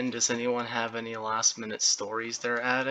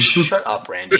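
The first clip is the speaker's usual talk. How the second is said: loud, high mock telling-off; mock outburst; 6 semitones higher, 8 dB louder than usual. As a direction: snap loudly at a teasing friend. Mock telling-off of teasing friend.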